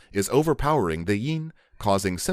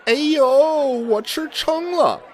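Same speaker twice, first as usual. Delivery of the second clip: high, groaning complaint about overeating; whiny stuffed diner; 6 dB louder than usual. Acted. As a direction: moan like you ate too much. Acting out stuffed diner dialogue.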